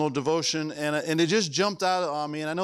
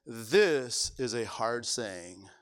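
quoted complaint; 8 semitones lower, 4 dB quieter, much slower than usual. Retold or imitated. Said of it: "Who said".

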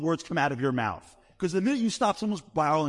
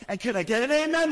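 preacher call-out; 10 semitones higher than usual. Retold or imitated. A rabbi rouses the congregation, preacher-style and high. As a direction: work the crowd like a Southern preacher. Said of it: "I'm like".

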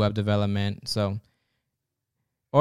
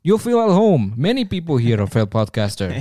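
mocking recruiter; 2 semitones higher, 4 dB louder than usual. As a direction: tease the new recruit, amused. Recruiter mocks failed podcaster.

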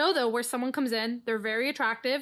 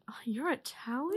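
soft whispered question; mock breath-only amazement; imitated intrigued stranger; 8 dB quieter than usual. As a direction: whisper it as if enchanted. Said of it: "The Italians on the ship were like".

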